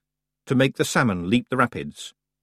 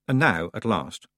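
plain reading voice

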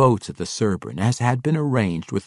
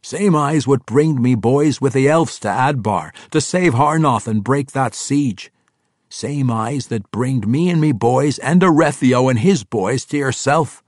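loud, higher dwarf warrior voice; 6 semitones higher, 7 dB louder than usual. Acted. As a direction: boom it out like a proud dwarf king. Brunner voiced in story.

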